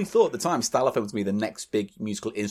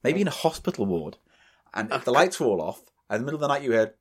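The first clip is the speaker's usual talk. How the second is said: ordinary talk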